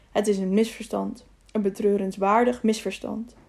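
ordinary delivery

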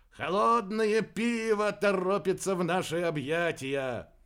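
high, slow, elated announcement; broad smile. A narrator's reading. Aerba welcoming cold beer, delighted. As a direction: relish it with a grin, drawing it out. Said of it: "широко улыбнулся Уэрба".

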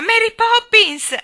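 very high, loud, drawn-out call; desperate scream. Acted. Jane with eyes shut calling Mary Poppins, screaming. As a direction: scream the name for rescue. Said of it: "gridò ancora".

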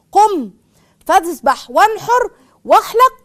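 high, loud, slow command; commanding call. Retold or imitated. Retelling Umm Salama's advice to the Prophet, loud slow command. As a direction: proclaim it slowly and forcefully. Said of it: "زي السيدة أم سلمة".

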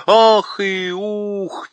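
slow, mournful owl voice; drawn-out hooting owl lament; sad owl hoot. A narrator's reading. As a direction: sigh it out like a sorrowful owl. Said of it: "печально произнесла сова".

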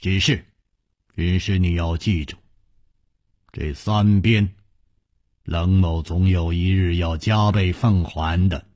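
low, deliberate vow with pauses; measured threat, pausing between phrases; slow, measured. A narrator's reading. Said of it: "缓缓地接着说".

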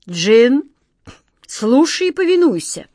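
loud, high imperious order; king calling out a command. Acted. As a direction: demand obedience.

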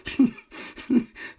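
high, scornful burst of laughter; mocking laugh. Moneylender laughing at the indebted lady, high mocking cackle. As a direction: laugh in her face. Lheureux mocks Emma's hope.

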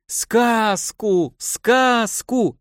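high chanting plea; children clamouring. A narrator's reading. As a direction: beg eagerly like kids. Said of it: "закричали дети". (дети выпрашивают сказку)

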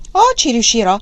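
bursting to take on the job; enthusiastic. A narrator's reading. Servant eager to arrange the match, enthusiastic. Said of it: "disse Cucù con entusiasmo".

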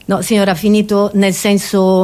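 ordinary delivery

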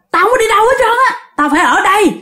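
fast, high-pitched outburst easing down; fierce defiance. Acted. Ghost refuses to leave the house, fiercely. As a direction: refuse to budge, indignant and high. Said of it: "Lúc đó bả làm dữ lắm".

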